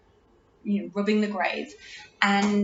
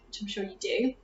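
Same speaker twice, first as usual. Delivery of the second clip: normal talking voice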